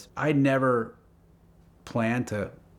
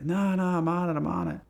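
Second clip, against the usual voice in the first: high, insistent retold reassurance; placating; 8 semitones higher than usual. Painter pressed by the owner about the car, placating. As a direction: protest that it is in hand.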